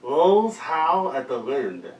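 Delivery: words mangled by a mouth guard; mouth guard speech. Real, not acted. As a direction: talk around a mouth guard.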